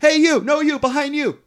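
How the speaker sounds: high-pitched singling-out call; mock calling out. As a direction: imitate a girl hailing someone in a crowd. Spice Girls calling to audience member.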